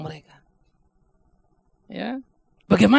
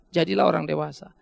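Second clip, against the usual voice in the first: ordinary talk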